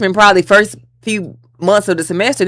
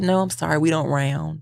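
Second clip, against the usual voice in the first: softer, final refusal; imitating the instructor; 8 dB quieter than usual. Instructor refusing to round the score, softer and firm. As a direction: say it softly and firmly. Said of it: "She said".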